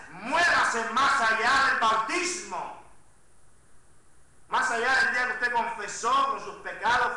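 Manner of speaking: loud, driving sermon delivery, words stretched for weight; full-force preaching. Acted. Pastor urging his congregation, loud full-force preaching. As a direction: press the congregation hard from the pulpit.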